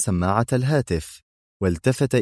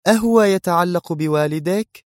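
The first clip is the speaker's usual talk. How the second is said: higher woman's voice, interested; attentive, raised-pitch question; concerned interest; 9 semitones higher than usual. A narrator's reading. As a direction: ask with a touch of concern. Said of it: "سألته في اهتمام".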